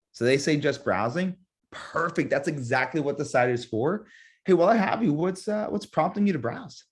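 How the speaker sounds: a curious "what's, uh" pause before "prompting"; deliberate pause. Shown as a demonstration. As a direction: hesitate on "what's, uh" like a real person. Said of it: "see if you guys catch what I put in there".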